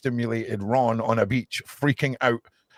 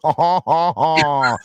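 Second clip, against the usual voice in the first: hammed-up ha ha ha, loud; a taunting stage laugh, louder; mock gloating laugh; 3 semitones higher, 6 dB louder, much slower than usual. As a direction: cackle at those who stayed home. Gloating over people who miss out.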